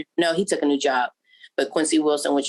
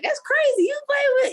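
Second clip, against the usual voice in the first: much higher excited self-quote; amazed squeal. Retold or imitated. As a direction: gush in disbelief. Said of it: "So I was like".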